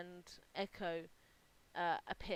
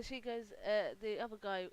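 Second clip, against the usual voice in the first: ordinary talk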